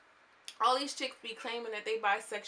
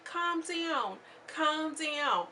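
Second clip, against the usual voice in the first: mock soothing plea, pitched high; retold pleading to calm down; 8 semitones higher, much slower than usual. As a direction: draw it out high, as if settling someone down. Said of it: "trying to ask Yodeler to calm down".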